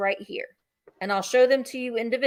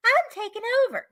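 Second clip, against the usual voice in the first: mock Easter Bunny villain; 4 dB louder, slower than usual. Seller voicing the bunny figurine to viewers, hammed-up and scheming. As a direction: play the scheming bunny taking over. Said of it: "he's like".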